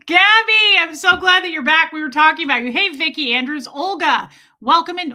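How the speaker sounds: loud, high-pitched delighted welcome; excited greeting. Real, not acted. Livestream host welcomes back returning viewers, excited greeting. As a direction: call out joyfully as a friend returns.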